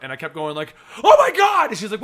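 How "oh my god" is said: high, loud gasp of excitement; excited exclamation. Retold or imitated. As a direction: burst out in disbelief. Reacting while reading comic.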